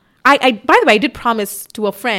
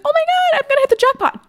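much higher squeal; squealing excitement. Retold or imitated. Watching her earnings climb, squealing excitedly. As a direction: squeal like you just won. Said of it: "I was like".

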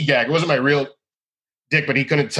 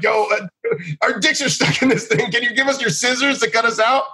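high, put-on innocent voice, much higher than his own; mock innocent plea; 11 semitones higher than usual. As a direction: play dumb and ask sweetly, pitched way up. Asking barber for scissors, retold.

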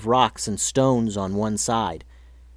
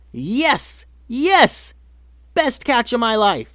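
thrilled cheer; 11 semitones higher, 4 dB louder, much slower than usual. Acted. Girl cheers her diving catch.

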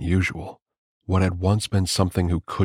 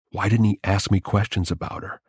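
usual speaking voice

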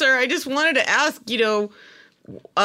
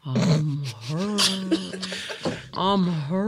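far lower, much softer; drowsy murmur; 9 semitones lower, 7 dB quieter, much slower than usual. Acted. Sleepy woman mumbling back to the director, drowsy. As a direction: mumble it half asleep.

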